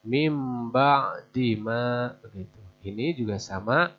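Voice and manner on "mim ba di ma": "mim ba di ma" slow and drawn out; iqlab reading. Shown as a demonstration. Teacher repeats the example for learners. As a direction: say "mim ba di ma" slowly. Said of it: "Nun diganti dengan huruf mim".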